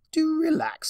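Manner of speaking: a sing-song mock soothing; grand showman calming her; Discord voice. A narrator's reading.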